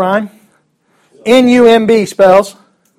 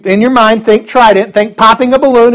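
ordinary delivery

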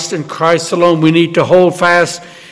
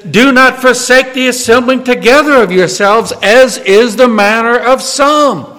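preaching; 6 semitones higher, 3 dB louder than usual. Acted. Reads scripture at full force.

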